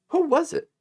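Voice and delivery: very high-pitched feigned cluelessness; mock-innocent question. Repeating the accused's question to ridicule it, mock-innocent. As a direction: play innocent, voice shooting up. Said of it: "Like he doesn't know".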